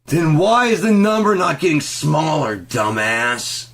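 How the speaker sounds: high, loud, strained demand; furious shouted question; angry yell. Retold or imitated. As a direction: blast the question at him. Berating the clerk about math.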